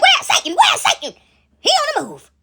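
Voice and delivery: very high, loud cries of alarm; hammed-up frantic calling out; mock alarmed shouting. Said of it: "They like".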